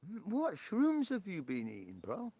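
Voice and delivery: high, loud mock disbelief; what shrooms. Real, not acted. Streamer teasing a viewer, high loud disbelief. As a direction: pitch shoots up in jokey disbelief. Mock-incredulous reaction to chat.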